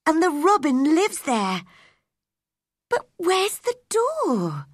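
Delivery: put-on high girl's voice, excitement turning to puzzlement; high and eager, stretching out the final question; excited. A narrator's reading. Mary wonders about the garden door, excitedly. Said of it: "she thought excitedly".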